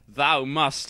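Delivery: plain reading voice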